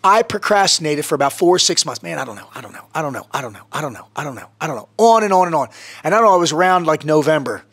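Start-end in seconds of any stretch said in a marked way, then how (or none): 2.0-4.9 muttered under the breath, much softer, going round in circles; a hint of a man stalling, barely audible; mumbled dithering. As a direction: mumble it to yourself like you can't decide. Mimicking his own procrastination.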